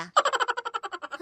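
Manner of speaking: high, loud gossipy cackle; sly teasing laugh. Acted. Neighbour mocking the daughter about her boyfriend, sly laugh. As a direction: laugh slyly at the girl.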